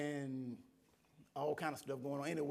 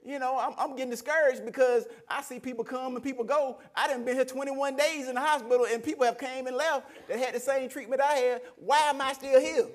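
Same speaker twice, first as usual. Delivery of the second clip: loud, high-pitched exasperated complaint; retold mad outburst; 6 semitones higher, 7 dB louder than usual. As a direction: protest with rising frustration. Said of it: "I'm mad now".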